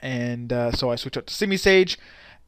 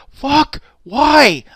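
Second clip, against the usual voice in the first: high, loud replay of frustration; exasperated outcry; 11 semitones higher, 9 dB louder than usual. Retold or imitated. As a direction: cry out in mock despair at bad luck. Reliving exasperation at another crit.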